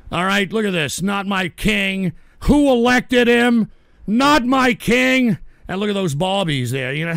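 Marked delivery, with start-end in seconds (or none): 2.4-5.4 loud, slow, raised chant; protester's cry. Acted. Streamer reading protest signs against the king, loud heckling cry. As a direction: draw out each word like a heckler.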